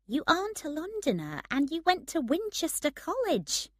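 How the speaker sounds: high-pitched, indignant correction; disapproving challenge. A narrator's reading. Woman calls out her friend's fake Londoner act, disapproving. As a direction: expose his pretence, incredulous.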